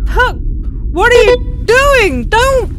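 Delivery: high, loud alarmed cry; desperate plea to stop him; frightened shout at the dog; panicked plea. Acted. Puck doing something dangerous.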